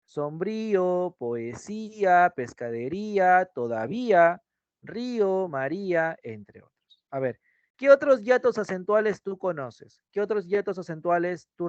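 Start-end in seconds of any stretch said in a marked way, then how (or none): 0.0-6.2 drawn-out vowels; slow, drawn-out spelling-bee delivery; slow example words. Shown as a demonstration. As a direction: stretch each word slowly. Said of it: "Acá también tienes".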